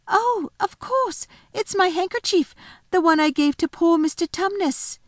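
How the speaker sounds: lightly voiced little girl, delighted. Narrator voices Lucy.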